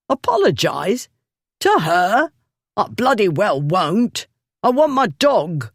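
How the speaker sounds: high, indignant bluster; outraged refusal. A narrator's reading. The drunkard refusing to apologize to the lady, high and indignant. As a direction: scoff at the very idea.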